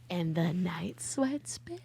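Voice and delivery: slow breathy whisper. Acted. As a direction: whisper it slowly for drama. Dramatic aside about night sweats.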